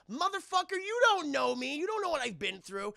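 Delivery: high, loud hurt anger; angry outburst. Retold or imitated. Scorpion answering Liu Kang's insult, angry. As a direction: lash out at Liu Kang. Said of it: "Scorpion's like".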